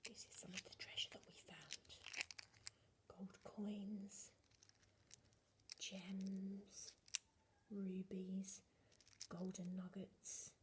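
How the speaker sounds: hushed whisper over treasure; secret whisper. Pretend. Teacher showing children secret treasure in a hushed whisper.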